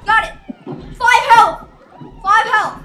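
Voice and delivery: loud warning yelled twice; urgent shout. Real, not acted. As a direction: bark the warning at the team. Warning low health.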